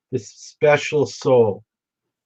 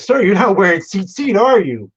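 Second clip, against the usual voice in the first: put-on higher, louder-edged Alabama accent; imitated Southern judge; 7 semitones higher, 5 dB louder than usual. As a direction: challenge him like a Southern judge. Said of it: "The Southern judge".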